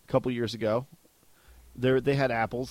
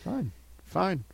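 mock grudging; 5 semitones higher, 5 dB quieter than usual. Acting out grudging acceptance of apples.

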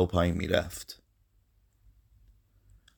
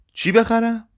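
raised, much higher-pitched question; louder question; 13 semitones higher, 4 dB louder than usual. A narrator's reading. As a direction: ask it louder, voice pitched right up. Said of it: "مرتضی بلندتر گفت". (بلندتر، با صدای زیر)

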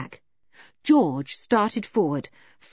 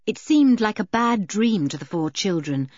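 ordinary delivery

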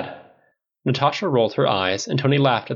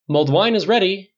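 higher, singsong announcement; calling out; 9 semitones higher, 4 dB louder than usual. A narrator's reading. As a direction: call everyone over. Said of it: "Bruce called".

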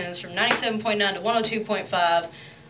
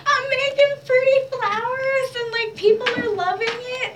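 giddy and excited; 3 dB louder than usual. Retold or imitated. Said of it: "And I was like".

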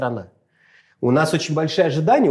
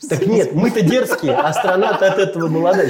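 laughing; 4 semitones higher, 3 dB louder than usual. Real, not acted. Joking correction with laughter.

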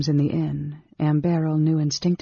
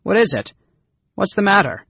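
higher, louder falling questions; alarmed concern; 5 semitones higher, 6 dB louder, faster than usual. A narrator's reading. Caramon catching fleeing Anne Beryl, alarmed and loud. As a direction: ask with sudden concern.

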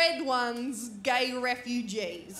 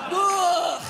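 disgusted groan; 5 semitones higher, 6 dB louder than usual. Real, not acted.

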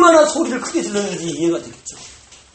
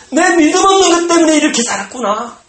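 anguished realization; 10 semitones higher, 6 dB louder than usual. Acted.

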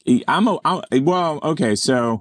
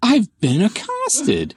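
high, slow, scandalized falsetto; mock outraged woman; 12 semitones higher, slower than usual. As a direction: gasp it out slowly, shocked and high. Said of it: "voicing woman after groping".

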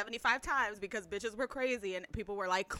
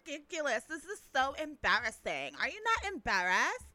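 mock scandalized girls; 4 semitones higher than usual. Imitating two girls appalled at the crowd, mock scandalized. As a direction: stretch the words in exaggerated disbelief.

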